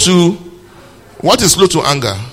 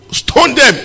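mock crowd shout; 11 semitones higher, 4 dB louder than usual. Preacher voicing the stoning crowd.

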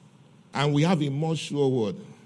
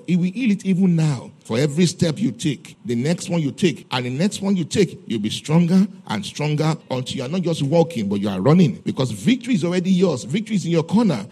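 louder, forceful prophetic proclamation; prophetic declaration; 4 dB louder than usual. Shown as a demonstration. Preacher interprets tongues as the Spirit's word, prophetic declaration.

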